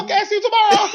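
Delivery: high, loud laughing reply; laughing mom impression. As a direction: laugh through mom's flat answer. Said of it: "She's like".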